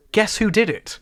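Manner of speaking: ordinary delivery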